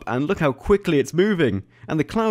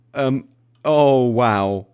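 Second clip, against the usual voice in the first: slow motion voice; 5 dB louder, much slower than usual. Pretend. Game running ultra slow.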